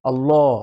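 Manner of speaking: আল্লাহ with a rounded, heavy llah, falling; full-mouth Allah. Shown as a demonstration. Teacher answers how Allah sounds at a sentence start. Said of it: "সেই জায়গাগুলোতেও কি হবে?".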